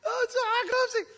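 high voice breaking with mock laughter; hammed-up hysterics; laughing. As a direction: squeal it through a laughing fit. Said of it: "למה אתה צוחק?".

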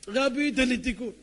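high, loud, slow begging; acted wailing appeal; tearful plea. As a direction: beg the rabbi through mock tears. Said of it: "התחיל לבכות".

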